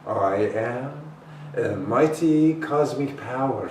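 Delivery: affirming it boldly; declared affirmation. Acted. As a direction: proclaim it with force. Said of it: "she says".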